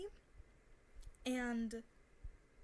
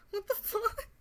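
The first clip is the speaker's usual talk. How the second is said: high, loud, laughing what the fuck; laughing disbelief; 10 semitones higher, 9 dB louder than usual. Retold or imitated. Hearing about his biceps, she laughs in disbelief. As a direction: crack up mid-word in disbelief.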